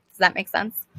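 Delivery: unvoiced aside to viewers; whisper. Real, not acted. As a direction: breathe the question, no voice. Checking in with viewers.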